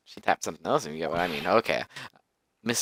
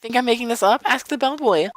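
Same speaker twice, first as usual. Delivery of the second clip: high, loud indignant voice; haughty witness, defiant; 6 dB louder than usual. Acted. Witness defending her alibi, high and defiant. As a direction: challenge them in a very high voice. Acts witness challenging the doubters.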